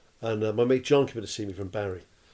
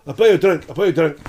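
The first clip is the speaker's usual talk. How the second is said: loud, insistent Scottish drinker; Scottish accent; 3 semitones higher, 8 dB louder, faster than usual. Retold or imitated. Old Scot insisting on treating visitors, loud Scottish accent. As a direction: press the offer like a Scottish barfly. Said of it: "this old Scottish guy at the bar".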